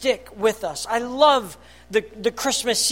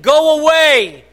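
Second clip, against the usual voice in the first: loud, higher screaming demon; demon crying out; 6 semitones higher, 10 dB louder than usual. Acted. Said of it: "he cried out at the top of his voice".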